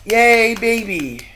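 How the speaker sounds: loud, high joyful yay; excited cheer. Real, not acted. Cheering the baby news, a loud joyful yay.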